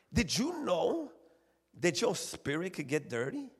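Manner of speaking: usual speaking voice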